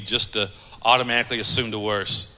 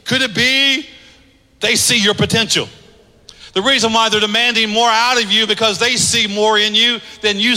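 raised, loud pulpit delivery, pitch high throughout; full-force preaching; 7 semitones higher, 4 dB louder than usual. Acted. Pastor preaching to the congregation, loud and raised. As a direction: drive each line home like a preacher.

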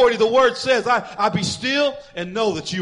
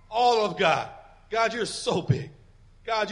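ordinary delivery

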